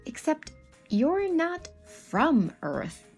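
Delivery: normal talking voice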